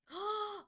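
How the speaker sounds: high gasp of delight; excited gasp. Real, not acted. Streamer gasps at a viewer's cute avatar.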